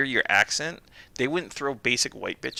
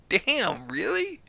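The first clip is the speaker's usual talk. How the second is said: high-pitched, incredulous mock reaction; high retold "no way" astonishment; retold surprise; 9 semitones higher, much slower than usual. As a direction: act out being taken aback, pitched way up. Retold reaction to friend's correction.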